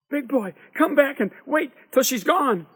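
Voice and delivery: high, loud plea to return; urgent calling. A narrator's reading. Boy begging his friend to come back, high urgent call.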